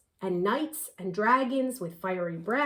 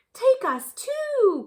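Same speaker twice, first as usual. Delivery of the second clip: high, louder and slow, eager plea; cried out; 13 semitones higher, 6 dB louder, slower than usual. Acted. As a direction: beg to come along. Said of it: "cried the pretty owls".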